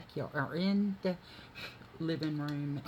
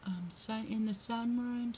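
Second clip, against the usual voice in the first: much higher coaxing voice for the cats; high cat talk; 10 semitones higher than usual. Real, not acted. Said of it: "I said".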